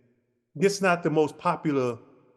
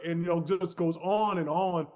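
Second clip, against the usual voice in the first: ordinary talk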